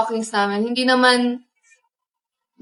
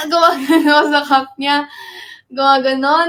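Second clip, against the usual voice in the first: ordinary delivery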